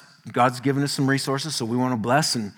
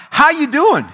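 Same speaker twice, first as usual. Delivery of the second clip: cheerful greeting; 11 semitones higher, 7 dB louder than usual. Retold or imitated.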